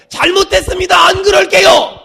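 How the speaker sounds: high, loud, pleading confession. Shown as a demonstration. Preacher leading the congregation in repentance, earnest pleading shout. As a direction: cry out the apology with urgent earnestness. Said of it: "더 간절하게".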